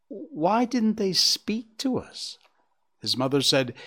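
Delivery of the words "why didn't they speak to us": put-on high, slow child's voice; drawn-out, high-pitched question from a young fawn. A narrator's reading. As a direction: plead for an answer like a puzzled little one. Child asks his mother in story.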